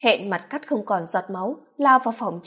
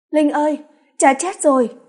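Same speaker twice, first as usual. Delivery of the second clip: loud tearful call, voice raised high; crying out; 5 semitones higher, 5 dB louder than usual. A narrator's reading. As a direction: cry out the terrible news through tears. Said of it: "Chị khóc giống lên gọi Linh". (gào khóc gọi em)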